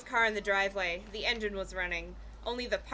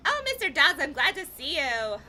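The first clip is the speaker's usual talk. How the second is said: high, loud, gushing greeting; glad, raised-voice welcome; happy cry; 6 semitones higher, 5 dB louder than usual. A narrator's reading. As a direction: cry it out happily. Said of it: "I cried happily".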